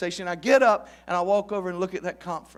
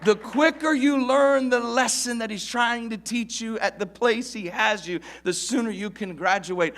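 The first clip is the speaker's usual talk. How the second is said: higher, slowed, emphatic restatement; preaching repeat; 6 semitones higher, 4 dB louder than usual. Acted. Preacher repeating his point to the church, emphatic. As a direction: press the point again.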